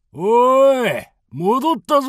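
高く張った大声で呼ぶ; 遠くの仲間に届くように声を張れ; 遠くへ呼びかけ. Acted. キャンプへ戻って呼びかける. (キャンプの皆に帰りを告げる、張った呼び声)

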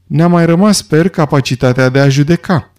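plain reading voice

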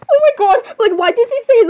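high, startled cry; startled exclamation. Acted. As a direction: burst out in high-pitched alarm. Sudden reaction to the threat.

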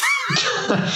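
a big squealing laugh that breaks out. Real, not acted. Host cracking up at his own joke, shrieking laugh. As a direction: burst into a high shrieking laugh.

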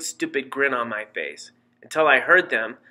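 usual speaking voice

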